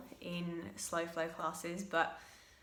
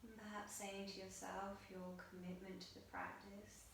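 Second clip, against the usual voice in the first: soft, hushed meditation guidance; guided meditation; 15 dB quieter than usual. Acted. Yoga teacher guiding students through breath on their mats, soft meditative voice. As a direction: guide the class gently into breath awareness.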